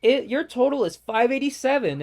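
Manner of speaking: high, loud chirpy service voice; mock the drive-thru worker's sunny script; customer service voice. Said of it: "he goes".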